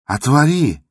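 high, loud shout; trembling shout. Acted. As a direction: cry out, shaking all over. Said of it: "крикнул Андрей Ефимович, дрожа всем телом". (дрожащий крик)

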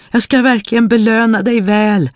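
high, loud urgent promise; pleading spirit. A narrator's reading. Trapped spirit promises the boy a reward, high pleading. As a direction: call out high, bargaining for your freedom.

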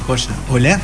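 normal talking voice

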